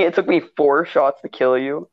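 ordinary talk

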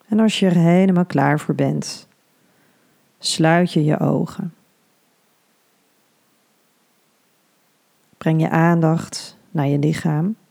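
soothing hypnobirthing guide; guided meditation. Acted.

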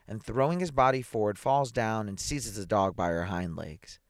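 plain reading voice

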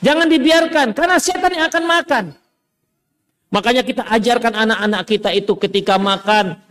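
usual speaking voice

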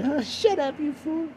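high, hammed-up dismissal; mock scolding. Heckler silencing podcaster about speaking Malaysian, mock scolding. Answering own objection in high voice.

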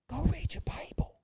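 slow whispered command from God. Retold or imitated. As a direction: whisper it slowly, as the Spirit speaking. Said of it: "Whispered it".

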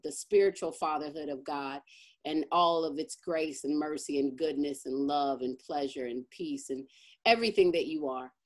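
earnest, steady closing prayer. Acted. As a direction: pray it softly and sincerely.

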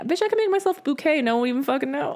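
high, sassy inner voice. Retold or imitated. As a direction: squeal it out sassy. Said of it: "I'm like".

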